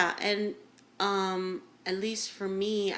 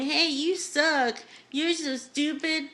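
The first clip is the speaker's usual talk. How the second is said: sneering, slow insult; mock taunting; 9 semitones higher, 4 dB louder, slower than usual. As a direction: taunt like an internet troll. Mocking online flamers.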